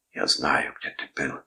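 a touch of sorrowful reproach; mournful reproach. A narrator's reading. Uncle reproaching his nephew over the night, mournful reproach. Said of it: "с печальным укором в голосе".

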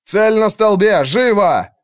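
high, loud, urgent command; shouted order. A narrator's reading. Commander gives archer his target, urgent shout. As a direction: order the shot at once.

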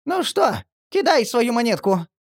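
very high squeaky hurry; impatient. A narrator's reading. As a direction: urge it impatiently. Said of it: "нетерпеливо поторопил Марли".

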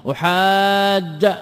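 أحاج drawn out in a slow sing-song with a rise, ending on a fatha; final fatha. Shown as a demonstration. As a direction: chant أحاج slowly, rising to an open final vowel. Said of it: "بالفتح". (أحاجَّ بالفتح بمد وترنيم)

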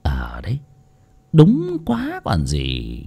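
whispered, slow agreement; murmured whisper. A narrator's reading. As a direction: murmur it under the breath.

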